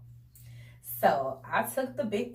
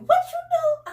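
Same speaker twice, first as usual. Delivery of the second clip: a much higher, louder startled squeal; sisters' shocked yelp; 8 dB louder than usual. Retold or imitated. Sisters asking what she did, a high shocked squeal. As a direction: shriek the question, half-laughing. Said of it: "my sisters was like".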